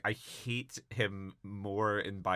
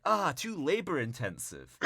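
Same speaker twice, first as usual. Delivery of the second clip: high-pitched complaint in imitation of a slacker; mock whiny refusal; 7 semitones higher than usual. Pauly turns down filling potholes, mock whiny refusal. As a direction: whine it to get out of work. Pauly rejects a second job idea.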